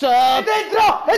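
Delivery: shouted, high, slow goal cry. Acted. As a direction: scream that it's in. Co-host shouts the late goal.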